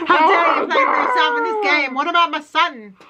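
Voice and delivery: high-pitched, hammed-up tearful rant; mock yelling. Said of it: "he goes and yells at Maddie".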